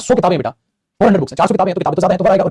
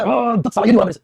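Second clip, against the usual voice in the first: higher-pitched mock cry of frustration; angry self-scolding; 5 semitones higher than usual. Teacher acting a student angry at own mistake, mock angry outburst. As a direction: act out cursing yourself in exasperation. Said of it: "कभी-कभी गुस्से में चिल्लाए".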